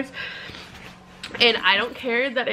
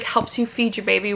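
usual speaking voice

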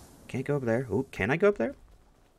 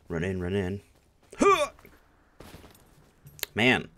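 normal talking voice

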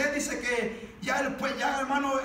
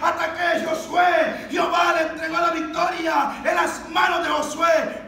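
raised, driving preaching voice; full-force preaching; 4 semitones higher, 6 dB louder than usual. Real, not acted. Preacher declares Joshua's God-given victory, full-force preaching. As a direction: proclaim the victory to the congregation.

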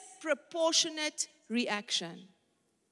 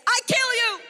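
high, louder mock-threatening voice; mock threat; 10 semitones higher, 5 dB louder than usual. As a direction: ham up a silly death threat. Preacher mocks the overreaction.

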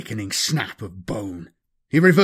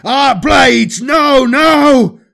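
loud, high shout breaking off; yell, then cry out in alarm; 11 semitones higher, 10 dB louder, much slower than usual. Acted. Cry cut off by a hit.

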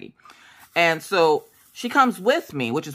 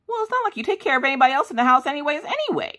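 high, fast mock-sassy retort; sassy comeback; 11 semitones higher, faster than usual. Retorting to her husband about dog care, sassy and fast. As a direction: toss it back at him, quick and cheeky. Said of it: "and I'm like".

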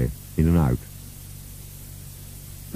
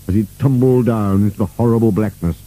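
ordinary delivery, nothing marked

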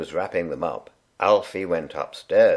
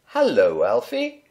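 Bernard's mum; 12 semitones higher than usual. A narrator's reading. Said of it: "she said".

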